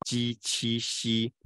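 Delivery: 鸡七吸 slow and even, each syllable held; drawn-out teacher's recital of 鸡七吸; tongue-surface drill. Shown as a demonstration. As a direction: model 鸡七吸 in a level tone, palatal consonants. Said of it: "一起念就更明显了哈".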